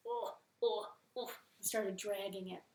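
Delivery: ordinary delivery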